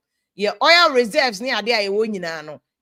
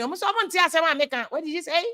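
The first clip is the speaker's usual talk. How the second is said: ordinary talk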